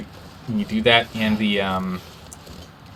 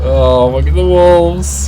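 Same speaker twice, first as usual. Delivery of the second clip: drawn-out alarm; 6 semitones higher, 9 dB louder, much slower than usual. Real, not acted. Alarmed at wolves.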